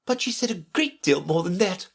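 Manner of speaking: high-pitched mild moan. A narrator's reading. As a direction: lament it in a lifted voice.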